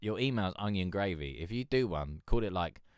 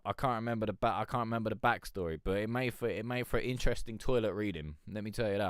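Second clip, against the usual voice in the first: ordinary delivery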